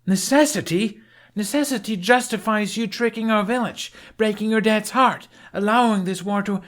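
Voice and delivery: furious accusation. A narrator's reading. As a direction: pile the charges on until cut off. Astrid's angry accusation, cut off.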